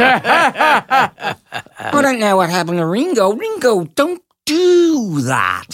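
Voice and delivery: high, slow squeaky Liverpool voice; cartoon [name]. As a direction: whine it like a bad cartoon Beatle. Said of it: "cartoon [name] voice".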